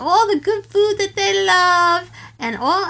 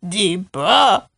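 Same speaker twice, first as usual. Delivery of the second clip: forced far down low; much deeper voice; 12 semitones lower than usual. Shown as a demonstration. Bella strains to copy Daddy-O, exaggerated deep voice. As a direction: push the voice right down to the bottom. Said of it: "trying to make my voice deeper".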